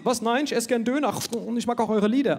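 flustered German. Retold or imitated. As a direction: scramble to prove you're nice.

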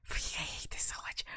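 whispered, frustrated aside; breathy whisper of frustration. Real, not acted. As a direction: hiss it under your breath.

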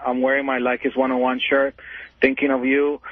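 normal talking voice

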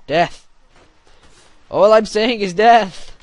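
loud, high, slow war cry; battle cry. Pretend. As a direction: draw the cry out at full voice.

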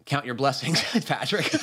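normal talking voice

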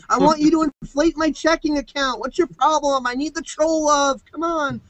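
high, whining, hammed-up begging; hammed-up high whine begging trolls for attention; mock whiny plea.

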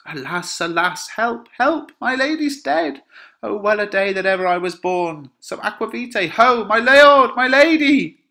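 high, loud theatrical wail building to a long shout; alarmed cry for help. Acted. Nurse finding her lady dead, alarmed calling. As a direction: wail and call out for the household.

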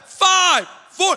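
high, loud called-out countdown. Retold or imitated. Studio crew counting down to air, loud countdown. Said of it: "they're counting down".

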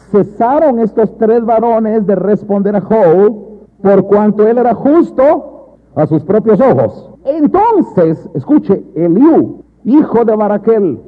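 loud, slow, drawn-out proclamation; emphatic scripture reading. Acted. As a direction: declaim the verse to the congregation.

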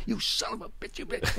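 high, hushed imitation of angry cursing; mock cursing. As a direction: curse like a furious driver, volume held down. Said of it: "he's cursing".

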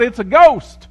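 high, loud cry of panic; terrified cry. A narrator's reading. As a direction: shout it out in sudden fear. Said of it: "they cried out".

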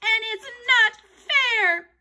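very high, loud outcry; high-pitched indignant shout; shouted protest. A narrator's reading. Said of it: "Andrea shouted".

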